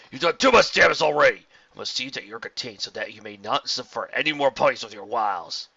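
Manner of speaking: loud, harsh commander voice; righteous angry captain; stern commander. A narrator's reading. Steelwing scolds the hero.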